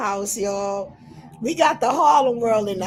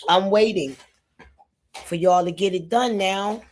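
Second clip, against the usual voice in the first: ordinary talk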